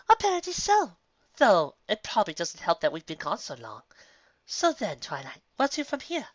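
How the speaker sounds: an elegant unicorn making polite talk; Rarity. Acted. Rarity voiced, refined.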